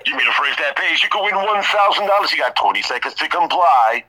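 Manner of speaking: high-pitched, punchy radio contest announcer; radio personality. Shown as a demonstration. Man showing off his radio personality voice on request, announcer style. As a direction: sell it like a big-city radio DJ. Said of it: "like, one of those radio personalities".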